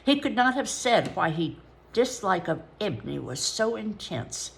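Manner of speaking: ordinary delivery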